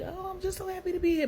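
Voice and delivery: put-on high excited child voice; happy little boy. Her son arriving at the shelter, high and delighted.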